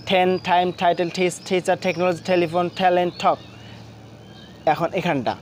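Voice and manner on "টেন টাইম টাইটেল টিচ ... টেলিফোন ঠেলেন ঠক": "টেন" through "ঠক" in one breath, each T aspirated as ঠ; one-breath drill. Shown as a demonstration. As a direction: rattle off "টেন টাইম টাইটেল" onward evenly without stopping, puffed T. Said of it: "এক নিঃশ্বাসে পড়ার চেষ্টা করি".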